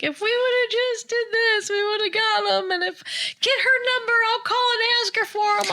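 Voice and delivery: high, pleading voice of a crying daughter; upset daughter. Retold or imitated. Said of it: "like, sobbing".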